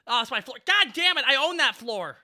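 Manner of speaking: high indignant yell; outraged shout. Real, not acted.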